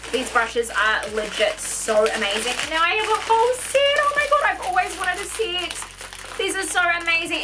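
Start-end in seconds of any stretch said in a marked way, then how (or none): 2.6-7.4 excited squeal. Real, not acted.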